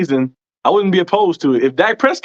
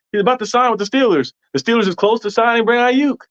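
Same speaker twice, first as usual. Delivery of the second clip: higher-pitched mimicry of breaking news posts; mock rumour hype; 7 semitones higher than usual. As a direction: imitate an eager rumour-monger, pitched up. Voicing hype posts people repeat.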